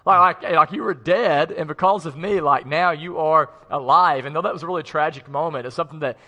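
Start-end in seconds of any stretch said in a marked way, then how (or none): 0.0-4.2 put-on exasperated teen shout. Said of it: "I'm still crying, and I'm like".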